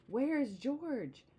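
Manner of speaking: slow, drawn-out call of worried sailors; calling out slowly. Acted. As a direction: stretch it out like sailors searching the water.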